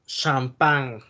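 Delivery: champán drawn out slowly with a French lilt. Shown as a demonstration. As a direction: give champán a little French tone. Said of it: "le dan el tonito de ahí francés".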